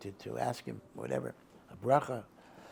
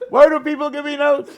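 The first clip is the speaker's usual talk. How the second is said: a big high shout, much louder; yelling; 19 dB louder than usual. Retold or imitated. A deaf rabbi shouting about the notes people give him, loud yell. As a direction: bellow it like a man who can't hear himself. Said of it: "he's yelling only because that's how he talked".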